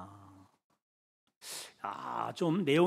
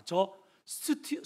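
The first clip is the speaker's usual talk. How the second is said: usual speaking voice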